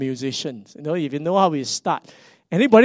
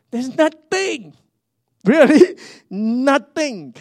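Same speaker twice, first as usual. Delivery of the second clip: incredulous; 10 semitones higher, 9 dB louder, much slower than usual. Real, not acted. No instruction manual, incredulous.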